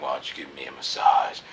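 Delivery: lightly voiced casual ask; low mock ask. Asking her for a massage, low and soft.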